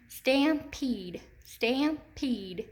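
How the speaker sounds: stampede split into STAM and PEDE, long E held; syllable-by-syllable demonstration. Shown as a demonstration. Teacher tests stampede with hand under chin, syllables separated. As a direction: say stampede in two deliberate beats, stam-PEDE. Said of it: "if I say it with my hand under my chin".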